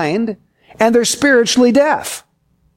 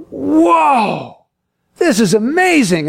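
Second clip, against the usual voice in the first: drawn-out, higher whoa of wonder; awed amazement; 4 semitones higher, much slower than usual. Acted. Tourists gazing at Yosemite's cliffs and waterfalls, awed. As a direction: play a tourist stunned by the view.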